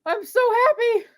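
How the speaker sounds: high, elated squeal of victory; overjoyed. Real, not acted. Co-host rejoicing for Cillian Murphy, overjoyed. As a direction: savour the win in a high voice. Celebrating the award win.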